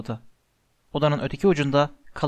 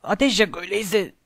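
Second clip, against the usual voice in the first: high, strangled voice; choked cry; 5 semitones higher than usual. A narrator's reading. Harry to Hermione as the plant strangles him, choked cry. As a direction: cry out as if being throttled. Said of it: "Boğulurcasına".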